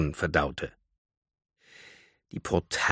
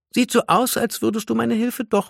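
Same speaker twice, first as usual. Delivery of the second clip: high, teasing singsong; mocking trill; 14 semitones higher than usual. A narrator's reading. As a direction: gloat lightly in a high lilt. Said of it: "trällerte sie spöttisch". (spöttisch trällernd, hoch)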